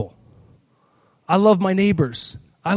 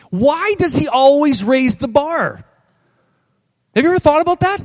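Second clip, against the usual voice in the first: ordinary talk, nothing marked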